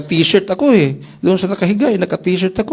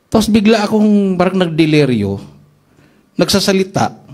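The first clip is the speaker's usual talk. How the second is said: normal talking voice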